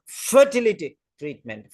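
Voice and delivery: "fertility" punched on its first syllable, treatment falling away; stress on fertility. Shown as a demonstration. Teacher showing which word will be stressed, "fertility" hit hard. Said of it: "Fertility will be stressed".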